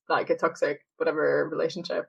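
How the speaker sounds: usual speaking voice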